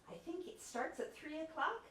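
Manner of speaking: rising, unsure and tentative. Shown as a demonstration. Lecturer demoing an unsure answer about a meeting time, tentative rising tone. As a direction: say it like you're not sure of the time. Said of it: "suggests uncertainty".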